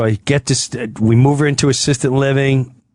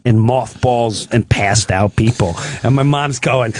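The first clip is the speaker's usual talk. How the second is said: ordinary talk, nothing marked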